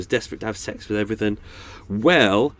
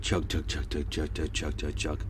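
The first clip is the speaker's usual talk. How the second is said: low, soft chugging engine sound; boat chug noise; 8 semitones lower, 9 dB quieter than usual. Shown as a demonstration. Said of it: "little boat's going past now".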